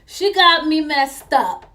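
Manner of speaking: loud, high, drawn-out indignation; fired-up, slow, offended exclamation; indignant outburst. Retold or imitated. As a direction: hit it loud and high, stretching every word. Indignant at officer yelling in her face.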